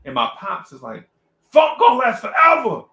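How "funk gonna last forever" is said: high, loud mock shout; indignant father. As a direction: imitate a dad outraged for his music. Father indignantly contradicts him.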